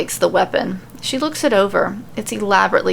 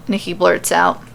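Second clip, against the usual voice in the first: ordinary delivery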